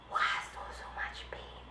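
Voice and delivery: whispered, breathy recollection of pain; hushed whisper of remembered pain; awed whisper. Real, not acted. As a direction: drop to a whisper in disbelief. Recalling the pain.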